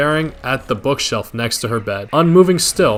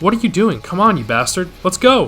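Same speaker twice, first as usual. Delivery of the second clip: egging him on; 6 semitones higher than usual. Retold or imitated. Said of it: "Egging him on".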